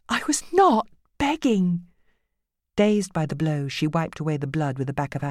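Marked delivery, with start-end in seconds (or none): 0.1-1.9 higher, slow pleading denial; frightened woman. A narrator's reading. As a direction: plead with him, scared. Judy voiced higher, pleading.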